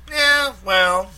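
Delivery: high, loud ribbing; teasing Mike. A narrator's reading. Mike teasing Mac about locking his door, high and loud. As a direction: needle your friend.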